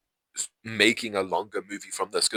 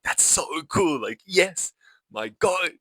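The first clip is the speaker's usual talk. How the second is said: high-pitched fan enthusiasm; gushing excitement; 9 semitones higher, 4 dB louder, slower than usual. Real, not acted. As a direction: gush loudly about something you love, voice leaping up. Gushing over a favourite film moment.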